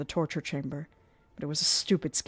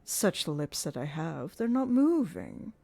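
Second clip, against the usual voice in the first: Erik ventriloquist; 6 semitones higher, 5 dB louder than usual. Acted. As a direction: toy with her like a showman.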